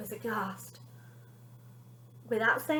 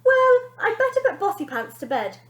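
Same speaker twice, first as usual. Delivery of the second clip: put-on high, loud innocent boy voice; cheeky boy; 10 semitones higher, 8 dB louder than usual. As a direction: dodge the question breezily. Benji evading, high.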